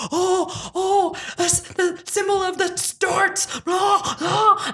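high, stretched-out wailing; hammed-up horror at an omen, wailed; mock hysterics. [name] reacts to the seer's vision, mock hysterical wailing. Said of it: "everyone's in hysterics".

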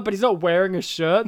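usual speaking voice

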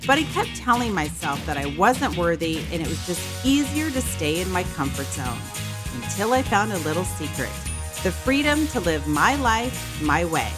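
upbeat host intro read; podcast intro. Acted. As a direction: read it like a show opener. Podcast intro read over music.

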